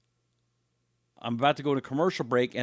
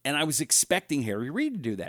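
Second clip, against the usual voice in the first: usual speaking voice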